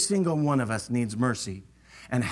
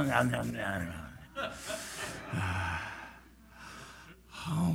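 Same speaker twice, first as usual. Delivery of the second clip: normal talking voice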